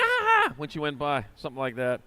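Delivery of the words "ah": high-pitched imitated "ah" cry; sudden high squeal, imitating her; imitated whoop. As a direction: mimic a racer crying out as she rides by.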